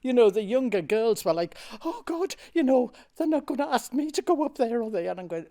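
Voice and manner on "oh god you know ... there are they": high, panicky imitation of young actresses; hammed-up dread, pitched right up; trembling with fear. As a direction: mimic nervous girls fretting aloud. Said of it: "all trembling with fear".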